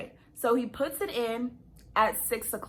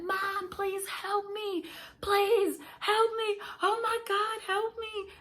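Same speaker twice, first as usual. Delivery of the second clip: high, drawn-out mock scream without full volume; retold screaming plea; 10 semitones higher, slower than usual. Labouring daughter begging mom from the bathroom, imitated screaming. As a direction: plead for help in a high, stretched wail. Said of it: "I'm in the bathroom screaming bloody murder".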